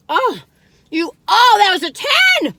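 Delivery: high and loud delight; excited. Real, not acted. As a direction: burst out with delight. Delighted outburst.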